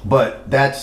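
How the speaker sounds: usual speaking voice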